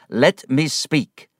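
slow, loud, insistent order; emphatic, drawn-out command; said loudly. Acted. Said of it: "Mister Spenlow said loudly".

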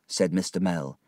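usual speaking voice

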